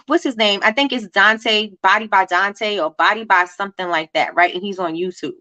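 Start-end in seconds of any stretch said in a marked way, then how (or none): none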